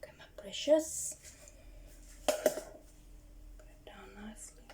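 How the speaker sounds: soft whispered coaxing. Real, not acted. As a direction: gently coax it like a pet. Coaxing spider to drop eggs.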